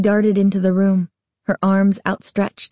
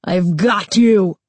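scary monster scream; 3 dB louder, slower than usual. A narrator's reading. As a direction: snarl it slowly like a monster. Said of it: "she screamed".

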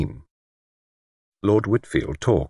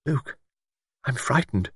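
put-on soft and higher, like a scared child; small childlike voice; 7 semitones higher, 5 dB quieter than usual. A narrator's reading. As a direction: shrink into a little frightened voice. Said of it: "in a small, childlike voice".

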